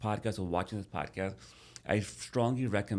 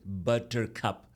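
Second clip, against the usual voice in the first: slowly; 2 semitones higher, much slower than usual. Shown as a demonstration. Speech therapist demonstrating the word slowly to stroke patient. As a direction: stretch out buttercup, very slow.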